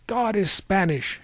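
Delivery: high outcry in an argument; indignant cry. A narrator's reading. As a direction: cry it out in defiance. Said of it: "she cried".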